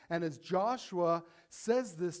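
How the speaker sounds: ordinary delivery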